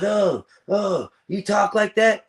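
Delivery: high, squeaky imitation of his daughter; a teen daughter mocked in a high voice; mocking daughter. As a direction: mimic her shocked, snippy tone way up high. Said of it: "And she goes".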